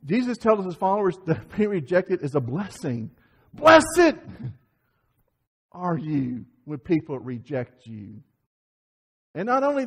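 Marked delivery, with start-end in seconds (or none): 3.5-4.1 loud, high preacher's shout; sudden booming emphasis. Real, not acted. Preacher to congregation on being rejected, booming. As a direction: punch it out at full force. Preacher booms the key word.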